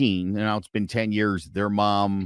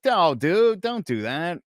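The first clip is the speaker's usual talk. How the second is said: mock pleading; 7 semitones higher than usual. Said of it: "It's like".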